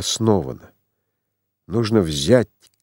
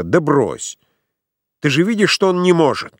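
higher-pitched, restrained calling out; mild narrated cry; 7 semitones higher than usual. A narrator's reading. Марта не вытерпела и кричит со своего места, сдержанный выкрик. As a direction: lift the pitch in protest without full shouting. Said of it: "она крикнула со своего места".

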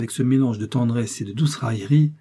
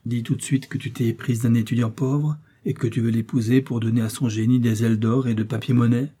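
plain reading voice

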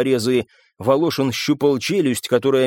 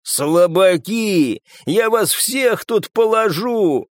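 drawn-out, higher battle cry; roaring threat; 7 semitones higher, much slower than usual. A narrator's reading. Славик to everyone he is fighting, roaring threat. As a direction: stretch the threat out defiantly.

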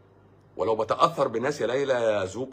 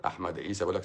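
ordinary delivery

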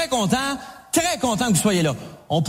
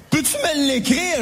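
normal talking voice

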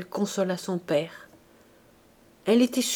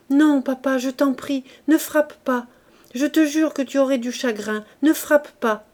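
put-on higher, urgent begging of a child; pleading; 5 semitones higher, 4 dB louder than usual. A narrator's reading. Sick child to her father with the whip, pleading. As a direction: beg him to stop, voice raised. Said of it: "suppliante, répétait".

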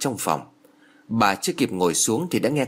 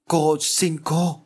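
slow, high, fading plea; begging as she blacks out; faint plea; 5 semitones higher, much slower than usual. A narrator's reading. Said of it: "chỉ kêu được mấy tiếng".

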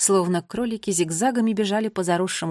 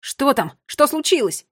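alarmed questions; 5 semitones higher, 5 dB louder than usual. A narrator's reading. Boy asks about alarming discovery.

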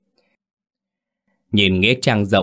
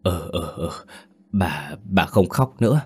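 put-on slow, halting old woman's hoarse reply; hoarse and subdued, with slow pauses; thick hoarse voice. A narrator's reading. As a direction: falter through hoarse little murmurs of assent. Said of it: "giọng nói khàn đặc".

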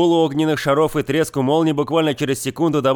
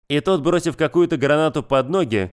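ordinary delivery, nothing marked

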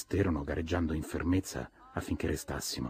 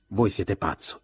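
a touch louder, bursting out; outburst; 3 semitones higher, 5 dB louder, slower than usual. Acted. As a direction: let it explode at Gatsby, mildly. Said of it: "esplose poi".

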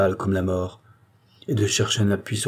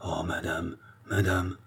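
murmured; 6 dB quieter than usual. A narrator's reading. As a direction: murmur it as a gentle reproach. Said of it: "murmura-t-il".

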